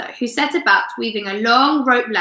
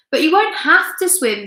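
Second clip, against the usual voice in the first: ordinary delivery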